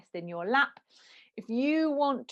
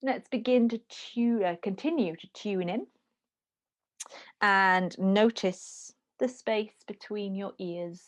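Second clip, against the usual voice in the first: guided meditation. Acted. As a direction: guide them into stillness, slowly. Leads a meditation.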